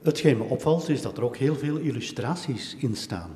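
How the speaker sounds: ordinary talk, nothing marked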